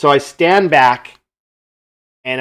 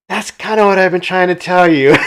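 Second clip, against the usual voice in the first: higher, louder, exasperated retort; exasperated told-you-so; 6 semitones higher, 7 dB louder than usual. Retold or imitated. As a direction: throw it back with a laughing sigh of told-you-so.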